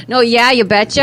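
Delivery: Minnesota "you betcha" with a lift; folksy Minnesota "you betcha"; Minnesota accent. Retold or imitated. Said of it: "Do you say hoser too".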